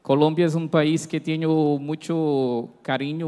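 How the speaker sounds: Spanish with a Brazilian Portuguese accent; Portuguese-flavoured Spanish, words mixed; portuñol. Real, not acted. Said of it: "ya pido perdón por mi portuñol".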